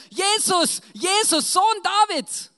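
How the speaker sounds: loud, high, drawn-out shout for attention; crying out. Acted. Blind beggar calls to Jesus as he passes, crying out. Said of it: "dann schreit er".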